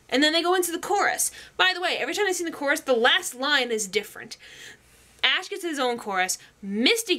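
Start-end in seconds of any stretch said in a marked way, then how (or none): none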